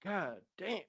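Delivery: barely gets the words out; choked up. Real, not acted. Host overcome reading a fan's recovery, choked up. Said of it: "that I'm getting emotional".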